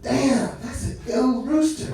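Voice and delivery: stretched, impressed retold reaction; taken aback. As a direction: act out marvelling at something impressive. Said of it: "we're, like, taken back".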